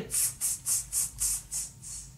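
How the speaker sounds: soft voiceless monkey "tss" noises; monkey chatter. Acted. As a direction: hiss short tss sounds like mimicking monkeys. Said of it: "monkeys only shook both their hands back at him and".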